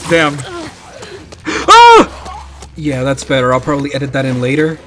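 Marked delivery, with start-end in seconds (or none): 1.5-2.7 high, loud cry; sharp scream of alarm; startled yelp. Real, not acted. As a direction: yelp in sudden fright. Startled in game.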